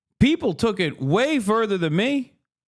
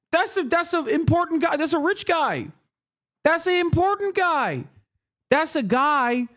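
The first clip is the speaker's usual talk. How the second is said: high, alarmed mock outrage; mock panicked elite; 7 semitones higher than usual. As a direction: play a scandalized rich defender, pitched up. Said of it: "they're like".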